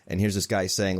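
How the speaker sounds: ordinary delivery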